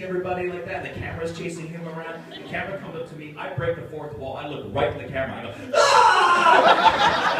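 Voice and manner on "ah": high, loud laughing scream; mock scream. Actor fleeing the rampaging Tuvok on camera. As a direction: shriek and run for it.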